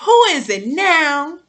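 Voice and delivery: slow, high and loud, startled; a hammed-up startled who-is-it; mock surprise. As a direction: wonder aloud who's there, drawn out. Acting out knock at door.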